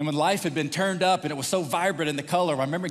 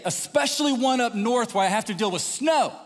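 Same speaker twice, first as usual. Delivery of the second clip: higher-pitched, emphatic boast; proud declaration; 7 semitones higher, 3 dB louder than usual. Retold or imitated. Proud Texan swearing off northern states, emphatic. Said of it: "I used to say things like this".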